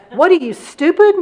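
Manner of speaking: high, loud mocking yell; shrill playground taunt yelled out. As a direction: snap it at her like an insult. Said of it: "and I heard her say".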